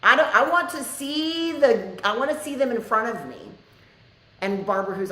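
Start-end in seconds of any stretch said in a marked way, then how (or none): 0.0-3.4 higher, louder, imitating her own insistence; insistent demand. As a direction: demand to see it, pitched up and pressing. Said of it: "and I was like".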